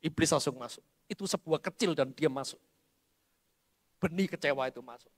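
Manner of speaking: normal talking voice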